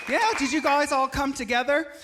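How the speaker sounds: usual speaking voice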